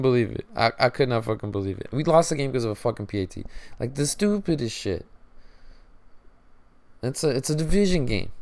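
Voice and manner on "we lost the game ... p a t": ordinary talk, nothing marked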